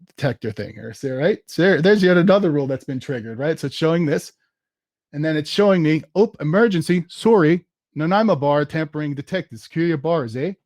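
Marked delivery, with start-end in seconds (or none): 6.1-10.5 cheesy fake Canadian accent with a tacked-on "eh"; bad Canadian accent. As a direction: put on an apologetic mock-Canadian voice, badly. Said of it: "really bad Canadian accent".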